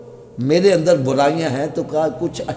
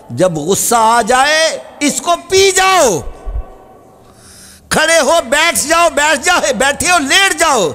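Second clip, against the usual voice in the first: impassioned sermon voice; fiery preaching; 9 semitones higher than usual. Acted. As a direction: drive the command home. Preacher exhorts with rising force.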